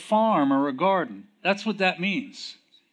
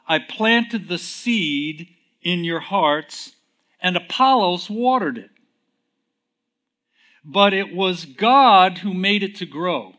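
rising scripture reading; 3 semitones higher, 6 dB louder than usual. Acted. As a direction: read it out like Paul speaking.

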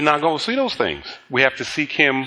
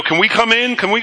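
worries begging to come in, higher pitched; higher, pleading little voices; 8 semitones higher than usual. Retold or imitated. Little worries beg the pastor to let them in, pleading.

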